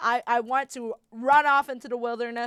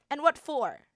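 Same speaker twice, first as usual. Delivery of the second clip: normal talking voice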